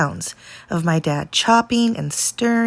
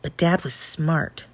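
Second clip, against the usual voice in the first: ordinary delivery, nothing marked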